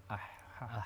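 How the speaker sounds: soft "Ah, ha" from the throat; makhraj huruf H. Shown as a demonstration. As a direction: demonstrate H at its articulation point, quietly. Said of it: "batasannya di makhraj huruf H sini". ("Ah, ha" pelan dari pangkal tenggorokan)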